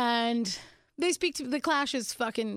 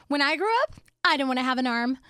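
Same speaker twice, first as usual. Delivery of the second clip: lightly voiced little girl, a touch louder; 5 dB louder, faster than usual. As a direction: quote a small child making an announcement. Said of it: "she looked at me and she goes".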